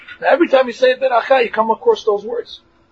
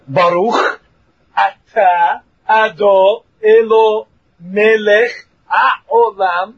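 "Baruch atah" said slowly, every word separate; slow careful blessing; much slower than usual. Shown as a demonstration. Teacher shows the correct way to say the blessing, slow. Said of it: "So you have to say".

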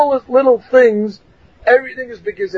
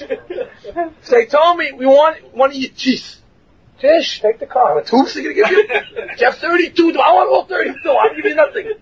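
higher, laughing through the words; laughing while talking; 7 semitones higher than usual. Real, not acted. Telling the class about an imagined trade for his teeth, laughing and higher. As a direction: keep laughing as you act the deal out.

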